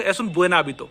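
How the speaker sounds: quick, joined-up "Es un buen hábito". Shown as a demonstration. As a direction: run "Es un buen hábito" together. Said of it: "जब मैं fast बोलूंगा".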